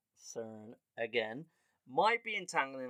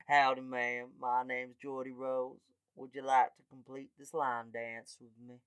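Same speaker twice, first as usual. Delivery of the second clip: Geordie Rose. Acted. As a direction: invite her like a hammed-up American cowboy. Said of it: "a guy named Geordie Rose".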